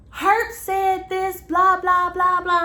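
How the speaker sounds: high, loud, slowed imitation of a frantic friend; mock frantic yelling. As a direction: ham up the friend's hysterical rant, blah blah in a high sing-song. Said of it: "She's yelling, she's screaming".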